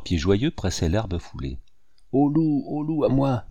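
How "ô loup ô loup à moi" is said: put-on boy calling for help. A narrator's reading. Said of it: "dit le jeune garçon".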